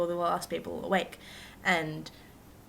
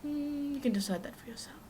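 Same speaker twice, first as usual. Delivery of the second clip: whispered coy tease; soft teasing whisper; 8 semitones higher, 5 dB quieter than usual. Real, not acted. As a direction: whisper it slyly to the viewers. Coy aside to viewers.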